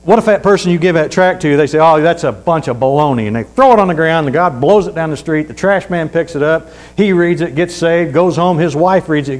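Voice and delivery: preaching. Acted. Preacher urging the church to hand out tracts, fervent preaching. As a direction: preach it out to the congregation, rising. Preacher building a chain of events.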